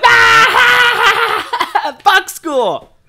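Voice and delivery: high, loud whoop through laughter; real laughter breaking the shout; laughing shout. Retold or imitated. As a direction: cackle and yell with triumph. Both laughing, driving off.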